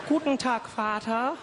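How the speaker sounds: high, proud German greeting. Pretend. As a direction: impress your father in his own tongue. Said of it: "I'll be like".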